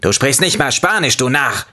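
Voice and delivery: the lemon mocks the narrator, high scolding voice. A narrator's reading. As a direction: berate him like a snooty lemon. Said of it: "über meine Unzulänglichkeiten zu schimpfen".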